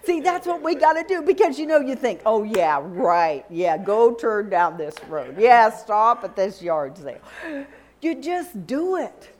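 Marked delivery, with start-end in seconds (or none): 2.2-7.2 lower, dismissive scoff; mock sceptical. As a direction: play the doubter brushing off an instruction. Mocks doubting thoughts about God's prompting.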